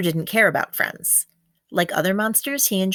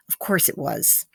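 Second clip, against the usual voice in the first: a soft resigned whisper; 2 semitones lower, 3 dB quieter than usual. Acted. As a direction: whisper the answer to himself. Xander's private conclusion.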